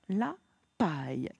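ordinary talk

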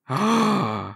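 drawn-out theatrical gasp; shocked gasp. Acted. Little man finding a third person, shocked gasp. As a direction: gasp in horrified discovery. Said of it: "Then the guy goes".

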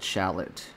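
"Shallot" said like the onion, SHAL-it; wrong town pronunciation. Shown as a demonstration. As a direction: stress the first syllable of "Shallot". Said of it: "I think it's actually pronounced Shalot".

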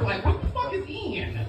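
normal talking voice

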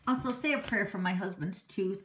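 usual speaking voice

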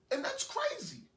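very high-pitched exclamation of disbelief; incredulous outburst. Acted. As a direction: let the voice leap up in exasperation. Rants about being left.

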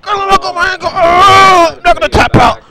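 high, loud hammed-up agony; play-acted shriek of pain, refusing to give in; screaming in pain. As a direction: wail over a wrecked ankle. Screaming over hurt ankle in play.

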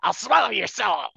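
high, loud gloating threat; loud villain line. Acted. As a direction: roar it like a hungry demon. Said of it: "my energy was more".

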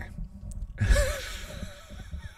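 breathless high giggle; laughing at her own joke; airy high laugh. Real, not acted. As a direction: let out a soft, airy laugh.

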